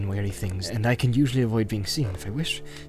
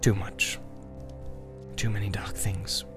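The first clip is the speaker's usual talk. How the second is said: grim; 2 semitones lower, 8 dB quieter than usual. A narrator's reading. Strider telling Frodo what he knows, grim. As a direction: say it softly and darkly. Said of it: "said Strider grimly".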